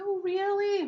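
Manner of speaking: put-on very high, amazed little-girl voice; tiny little voice. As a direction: imitate a little sister's thrilled surprise. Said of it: "this tiny little voice of hers".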